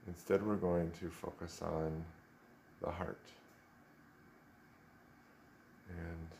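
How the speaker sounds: soft, unhurried meditation voice with long pauses; guided meditation. Real, not acted. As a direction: gently guide them into stillness, leaving space after each instruction.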